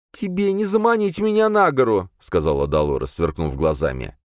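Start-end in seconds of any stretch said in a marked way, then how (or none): 0.1-2.1 high-pitched heated refusal; high, agitated defiance; defiant cry. A narrator's reading. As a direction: refuse him, voice raised high. Defiant woman refusing the ghost.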